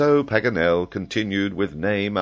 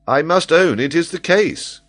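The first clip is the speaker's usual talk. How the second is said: amused admission; 12 semitones higher, 7 dB louder than usual. A narrator's reading. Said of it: "amidst a general outburst of laughter".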